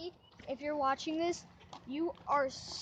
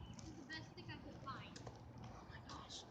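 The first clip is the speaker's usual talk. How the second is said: whispered; 15 dB quieter than usual. Real, not acted. Whispered aside at the fleas.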